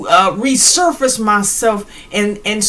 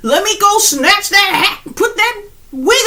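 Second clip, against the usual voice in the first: giddy copycat; 6 semitones higher than usual. Retold or imitated. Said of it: "We've been like".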